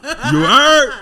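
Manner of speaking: high, loud mock New York "You heard?" with a laugh; New York accent. Imitating the Brooklyn girl's question, in a hammed-up New York accent. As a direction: punch "heard" like a Brooklyn girl. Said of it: "And then she goes".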